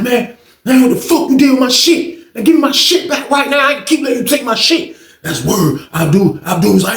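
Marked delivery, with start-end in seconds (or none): 0.0-5.0 angry demand. Retold or imitated. Demanding his stuff back.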